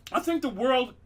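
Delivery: normal talking voice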